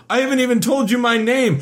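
high, wailing prince; mock whiny prince. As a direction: whine it like a lovesick prince. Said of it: "he's like".

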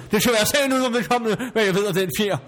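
high-pitched, indignant brush-off; indignant rebuff. Acted. Quarrel, refuses to answer. (forarget afvisning i højt toneleje)

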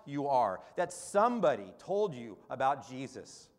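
loud, high, fervent preacher voice; impassioned sermon climax; preaching at full force. Acted. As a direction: proclaim it from the pulpit, rising to a cry.

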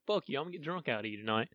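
quickly and softly tell the mug off; quiet pep talk. Retold or imitated. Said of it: "that's her overcoming her fears and like".

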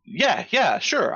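high-pitched, too-quick agreement; overeager assurance. Acted. Asked if he got the code, high overeager bluffing.

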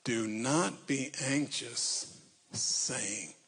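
slow emphatic. Real, not acted.

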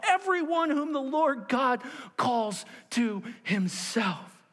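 high, urgent proclamation at full force; impassioned preaching. Acted. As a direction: preach as Peter to everyone listening. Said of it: "Peter said".

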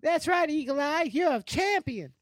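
squeaky high voice, teasing praise; mock high squeal. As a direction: cheer him on in a silly high squeak. Mocking high cheer.

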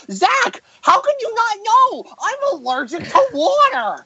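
very high, put-on nasal whine; mocking whiny friend. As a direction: squeal it like a dramatic friend. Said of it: "And Zach, he's like".

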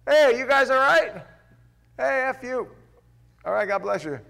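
calling out loud and high to strangers fighting, half joking; mock friendly call-out. Shown as a demonstration. Preacher demoing calling out to brawling kids, loud and high. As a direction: shout a breezy hey across the street. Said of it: "best thing to do".

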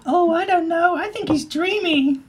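put-on high, dreamy girl voice; swooning high voice. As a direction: gush over the boy.

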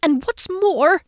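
high, slow, strained voice; tight-throated. A narrator's reading. Friend rigid and quivering, tight-throated. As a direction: squeeze it out, quivering with tension. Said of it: "he said with a tight throat".

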